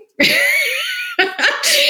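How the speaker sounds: a sudden high, bright burst of laughter; laughing at the mic-working excuse. Real, not acted. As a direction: crack up at your own joke. Laughs at own joke.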